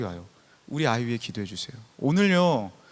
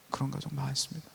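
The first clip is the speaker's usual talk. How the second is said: much softer, almost to oneself; soft echo; 9 dB quieter than usual. Shown as a demonstration. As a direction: repeat it quietly, letting it sink in. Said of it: "pastor's reply said twice".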